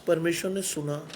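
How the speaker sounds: low, soft, understated statement; hushed awe. Real, not acted. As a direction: say it softly and low, letting it sink in. Climax of testimony, first take. (धीमे, दबे स्वर में)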